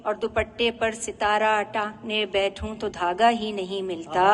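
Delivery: measured nazm recital, each phrase set apart; poetry recitation. Acted. Poet presenting a nazm about drawing eyes, poetry recitation. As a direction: recite line by line with lingering endings.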